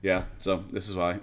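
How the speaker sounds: ordinary talk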